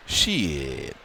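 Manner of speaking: low, quiet grumbled curse; a muttered low mock Josh; imitating Josh. Host imitates Josh on the show, low and quiet. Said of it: "Here's Josh on the, on At the Fights".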